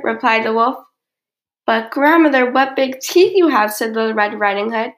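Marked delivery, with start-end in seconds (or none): 1.7-3.7 slightly quivering. A narrator's reading. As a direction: marvel loudly with a slight quiver. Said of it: "her voice quivering slightly".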